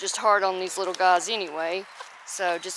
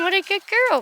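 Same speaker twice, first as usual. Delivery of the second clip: much higher squeaky praise; pet praise; 10 semitones higher, 4 dB louder than usual. Real, not acted. Praising the hen Bamman, high baby-talk voice. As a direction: gush over the hen.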